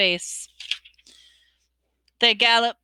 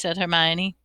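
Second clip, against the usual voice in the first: ordinary delivery, nothing marked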